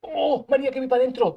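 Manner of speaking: loud, high burst of hype; excited shout. Real, not acted. Streamer calling to María, loud and high with excitement. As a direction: yell it out as the play turns. Card game turning, calls to María.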